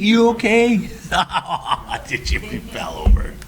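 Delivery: high-pitched, hammed-up check-in, tipping into laughter; mock concern. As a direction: play at worrying before the laugh. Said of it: "will first ask if I'm okay before y'all laugh".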